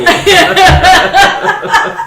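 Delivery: loud high burst of laughter. Real, not acted. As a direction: crack up loudly. Big laugh.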